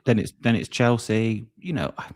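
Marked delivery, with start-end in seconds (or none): 1.6-2.1 talking through a burp; words cut by a beer burp. Real, not acted. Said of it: "I'm burping from drinking too much beer".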